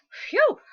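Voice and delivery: high breathy whistle of relief; dad's huge sigh of relief; relieved phew. A narrator's reading. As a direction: blow out a big phew. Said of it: "said Dad later".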